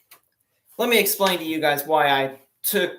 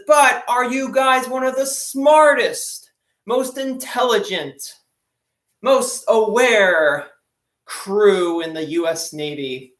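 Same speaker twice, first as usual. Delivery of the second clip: much higher, slow and louder, grandly probing; grand admiral; 6 semitones higher, 5 dB louder, slower than usual. Retold or imitated. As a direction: stretch each phrase like a showy admiral. Admiral quizzes the crew.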